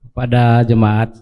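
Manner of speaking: ordinary delivery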